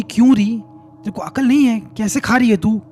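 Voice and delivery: angry scolding. Acted. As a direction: scold like an outraged mother-in-law. Grandmother angrily scolds her daughter-in-law.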